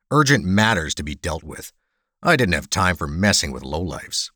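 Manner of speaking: ordinary delivery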